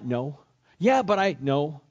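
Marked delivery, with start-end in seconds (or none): 0.8-1.4 loud, high whiny "Yeah, but I"; same hammed-up protest; mock excuse. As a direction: repeat the pleading excuse.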